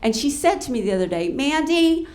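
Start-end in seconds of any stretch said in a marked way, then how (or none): 1.3-2.1 high-pitched imitation of a woman; impersonating a friend's higher voice; fiancée's voice. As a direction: mimic her unsexy voice, pitched up. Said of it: "that's her voice. I'm not making fun of her".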